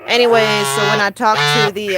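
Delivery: normal talking voice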